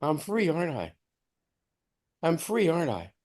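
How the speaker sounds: hushed, soft wonder; awed whisper-soft question; quiet realization. Retold or imitated. Dawning realization.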